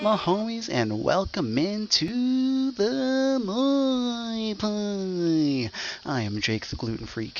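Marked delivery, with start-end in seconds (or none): 0.0-5.7 high, loud, drawn-out hype; hyped greeting. Acted. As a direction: stretch it out like a showman. Hype show opener.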